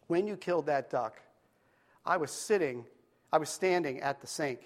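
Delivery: soft, tender, unhurried confiding; gentle grandma. Acted. Grandma gently tells her grandson she saw everything, soft and tender. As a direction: lean in kindly and tell him.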